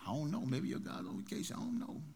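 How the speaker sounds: soft, half-whispered teasing; sarcastic mock whisper. Elijah mocking the prophets of Baal with sarcasm. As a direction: taunt them under your breath. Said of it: "a bit of sarcasm".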